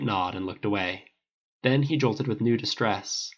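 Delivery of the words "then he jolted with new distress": plain reading voice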